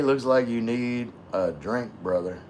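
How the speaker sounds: usual speaking voice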